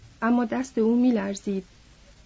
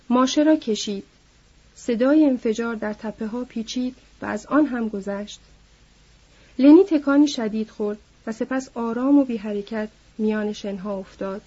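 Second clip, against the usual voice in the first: plain reading voice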